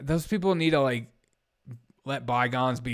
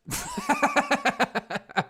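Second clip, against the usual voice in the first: high laugh. Real, not acted. Laughs at own joke.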